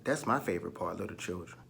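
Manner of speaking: plain reading voice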